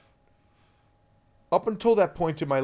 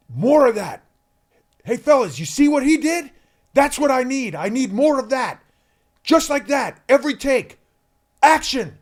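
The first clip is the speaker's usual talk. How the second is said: much louder and higher, all praise; enthusiastic director; 11 semitones higher, 11 dB louder than usual. Retold or imitated. Director praising the kid to the other boys, loud excited. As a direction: cheer the boy on to the whole cast. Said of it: "And he went".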